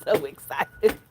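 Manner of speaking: giggling through the words; laughing with excitement. Real, not acted. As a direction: laugh out your excitement about the garden. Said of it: "I'm excited".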